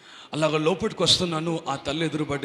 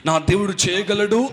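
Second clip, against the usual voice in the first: raised, emphatic declaration of courage; bold faith; 4 semitones higher, 6 dB louder than usual. Acted. Preacher declaring his God is able, boldly. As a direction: declare it boldly, pitch lifting. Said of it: "అన్న ధైర్యంతో".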